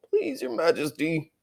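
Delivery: high, soft, humble plea; an old fox pleading with the queen, high and soft; timid old fox. Acted.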